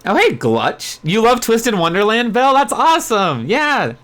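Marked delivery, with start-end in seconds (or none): none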